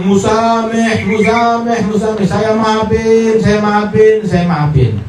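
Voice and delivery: high, slow, drawn-out pleading forgiveness; the forgiving man at home finally lets go, slow and high; voiced forgiving man.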